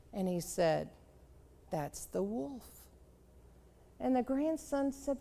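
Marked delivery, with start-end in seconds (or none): 1.7-2.9 calm grandpa. Acted. Grandpa answers calmly.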